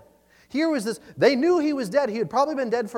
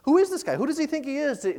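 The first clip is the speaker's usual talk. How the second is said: indignant; 7 semitones higher than usual. Retold or imitated. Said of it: "everybody would start to murmur".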